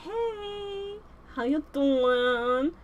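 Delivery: slow, drawn-out sly eels. Acted. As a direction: coax her slowly like a sneaky eel. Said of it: "Flotsam Jetsam go".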